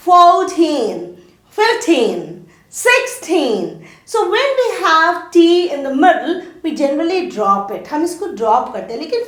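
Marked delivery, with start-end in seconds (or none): none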